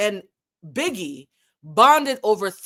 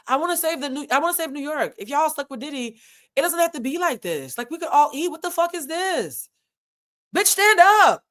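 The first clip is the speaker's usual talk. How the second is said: [name]; 7 semitones higher than usual. Retold or imitated. Host voices [name] addressing New York, high impassioned plea. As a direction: plead at a high pitch, then burst out. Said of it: "[name]'s, like, on camera saying".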